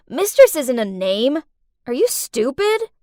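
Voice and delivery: loud scoff, rising into disbelief; scornful. Acted. Captive girl to mistress about her name, scornful. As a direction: scoff and mock the silly answer.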